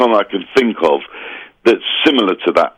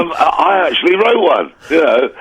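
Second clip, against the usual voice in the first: higher, lightly held Savile voice; fading Savile mimicry, still raised; Jimmy Savile impression; 8 semitones higher than usual. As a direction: keep the Savile send-up going, a little higher. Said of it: "Jimmy Savile".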